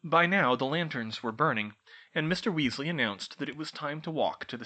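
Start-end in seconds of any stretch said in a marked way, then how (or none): none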